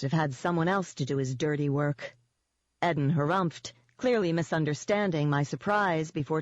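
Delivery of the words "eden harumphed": usual speaking voice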